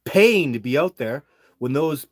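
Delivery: real exasperated venting about the agency. Real, not acted. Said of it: "I'm just venting a little bit".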